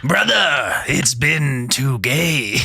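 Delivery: high, loud hammy villain yell; over-the-top villain cry; villain shout. Pretend. Hammed-up Metal Gear villain calls.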